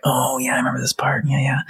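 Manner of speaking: whispered oh yeah, I remember this part; whispered recognition. Retold or imitated. Reader rediscovering a remembered scene, whispered recognition. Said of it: "go back and read it and be like".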